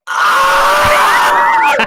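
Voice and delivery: sharp gasp, then a piercing mock scream; very high, loud, sustained imitation shriek; imitated baby scream. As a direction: scream like a baby that got stabbed. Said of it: "it's just screaming".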